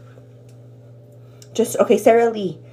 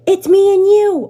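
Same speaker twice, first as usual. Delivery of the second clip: excited; 9 semitones higher, 10 dB louder than usual. Real, not acted. Excited about the minority poll result.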